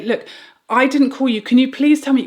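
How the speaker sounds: high and loud, pleading but insistent; loud firm demand. Retold or imitated. Mother demanding the ambulance caller explain, loud insistent plea. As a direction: demand an answer, near shouting.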